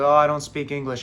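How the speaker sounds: ordinary delivery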